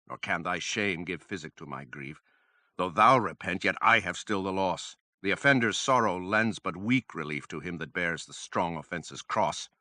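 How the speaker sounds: ordinary delivery, nothing marked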